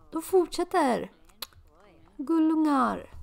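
high, loud gush. Real, not acted. Player gushing over two flirting Sims. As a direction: coo over how cute they are.

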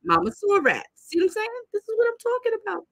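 high, emphatic "This is what I'm talking about"; exasperated exclaim. Real, not acted. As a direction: throw the line up high in exasperation. Exasperated pointing out to viewers.

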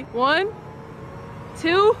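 building countdown. Real, not acted.

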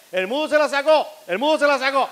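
very high, loud screech; an old woman shrieking in shock; hammed-up granny scream.

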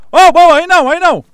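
panicked shout. Real, not acted. Streamer yelling as the fight turns on him. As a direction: yell in panic as it goes wrong.

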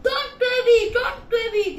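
high, loud shout of reassurance; shouting back. Acted. Villagers answer the shepherd boy from afar, shouting back. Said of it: "they shouted back".

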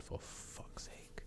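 very soft, slow whisper; whispered curse. Real, not acted. Player lacking a sharp stone shard, exasperated whisper. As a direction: curse slowly under your breath. Muttered curse at missing game item.

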